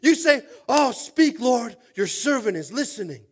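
high, very loud preacher's shout; full-force preaching. Real, not acted. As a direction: build to a roaring altar call, then ease slightly.

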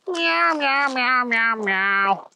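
high mewing kitten voice; cat meow. Acted. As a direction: mew it like a small cat. Cat tries to explain.

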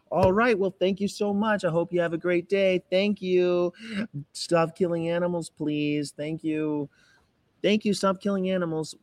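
high, sing-song sweet thank-you voice; saccharine nice activist. Acted. Activist thanking rude passers-by, saccharine sweet. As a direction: lay it on thick, high and over-polite. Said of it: "I'm usually like".

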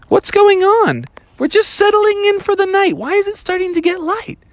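put-on high-pitched, squeaky-kid confusion; bewildered cubs. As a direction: ask in a high, baffled child's voice. Bears puzzled by dawn.

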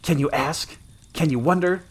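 high, rising rhetorical questions; gritted fury. A narrator's reading. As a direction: contempt through the teeth, climbing in pitch. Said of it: "gritted the girl between her teeth".

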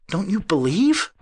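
high, loud, slow question; stammered disbelief. A narrator's reading. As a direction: ask in dismay, voice climbing. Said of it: "he stammered".

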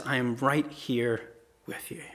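slow, calm, tender answer; a father's quiet, steady reply; gentle reassurance. Acted. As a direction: softly comfort a frightened child. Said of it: "And he says".

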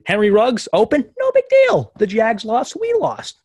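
gleefully celebrating a loss, sarcastic. Retold or imitated. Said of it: "It's annoying knowing that".

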